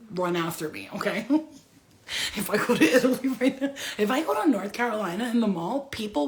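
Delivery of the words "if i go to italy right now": repeated through a laugh; laughing as she restarts; giggling restart. Real, not acted. Repeats line laughing, louder.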